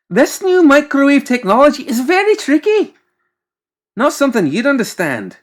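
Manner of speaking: high, loud lofty lecture; condescending. Acted. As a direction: talk down to the servant. McMorning condescending.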